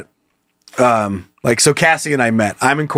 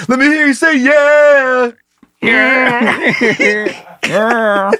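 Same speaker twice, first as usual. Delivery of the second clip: hype man; 9 semitones higher, much slower than usual. Acted. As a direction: pump up the crowd.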